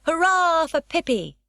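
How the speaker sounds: high, loud cheering shout; crowd cheer. A narrator's reading. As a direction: roar it like the stamping crowd. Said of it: "and shouted".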